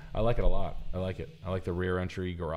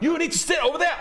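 high loud laughing yell; panicked shriek; 6 dB louder, faster than usual. Retold or imitated. Continues panicked quote.

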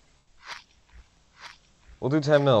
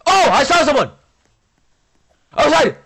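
high, loud sudden yell; excited shout; 11 dB louder than usual. Real, not acted. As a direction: shout the alarm. Sudden sighting in game.

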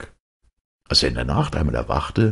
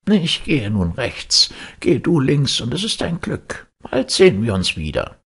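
kindly Death, voiced much higher; Death's voice; 11 semitones higher, 3 dB louder than usual. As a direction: speak as gentle Death, pitched up. Death at the crossroads.

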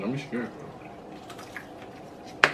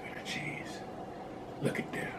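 whisper; 17 dB quieter than usual. Real, not acted. Marvelling at dripping cheese.

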